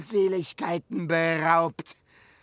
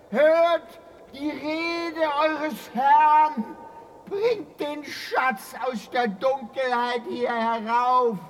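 commanding summons; 9 semitones higher, slower than usual. Acted. Dwarf lord summoning his slaves from below with slow ringing command. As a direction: call down into the depths like a master.

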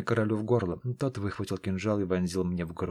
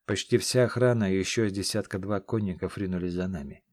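plain reading voice

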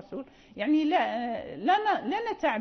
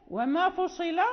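ordinary delivery

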